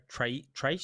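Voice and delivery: ordinary talk, nothing marked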